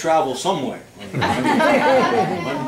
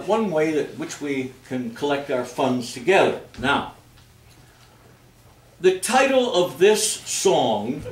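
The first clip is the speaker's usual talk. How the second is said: ordinary delivery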